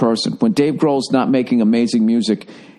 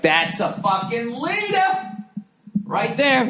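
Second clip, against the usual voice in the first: much higher, softer, slow admiring tone; 12 semitones higher, 5 dB quieter, slower than usual. Retold or imitated. Watching LeBron calm his teammate, hushed high admiration. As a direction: praise him like you're impressed. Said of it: "And I was like".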